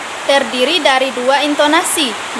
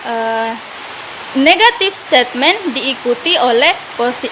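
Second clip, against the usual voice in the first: ordinary delivery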